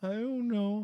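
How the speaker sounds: put-on sulky kid. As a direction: shrug it off like a guilty kid.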